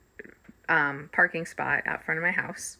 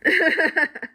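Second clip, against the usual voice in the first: burst of laughter; 10 semitones higher, 6 dB louder than usual. Real, not acted. Laughing at own story.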